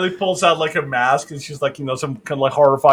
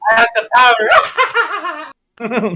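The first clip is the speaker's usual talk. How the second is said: hammed-up villainess, cackling into it; Yzma; 6 semitones higher than usual. Imitating a politician as cartoon villain, cackling. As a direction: play a power-mad cartoon villainess. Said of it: "like Yzma from".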